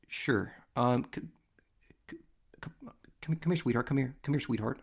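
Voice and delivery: halting, stammered reluctant call; imitating awkward dad. Dad hesitantly calling his daughter over to the scout, acted awkwardness. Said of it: "now he's getting awkward".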